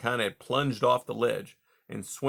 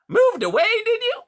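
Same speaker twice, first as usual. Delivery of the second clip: much higher, louder hearty voice; hearty beaver; 9 dB louder than usual. A narrator's reading. Beaver Mister Conrad greeting caged Rye, high and hearty. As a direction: boom out a jovial salesman beaver.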